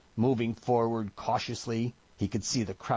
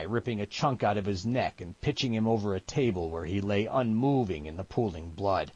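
usual speaking voice

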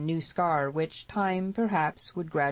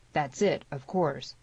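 ordinary delivery

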